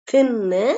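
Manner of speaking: தின்னு with a strong voiceless th; firmly pressed TH. Shown as a demonstration. Teacher repeating strong th for learners, pressed. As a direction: push the th of தின்னு. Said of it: "நல்லா அழுத்தி உச்சரிக்கிற".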